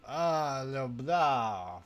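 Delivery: normal talking voice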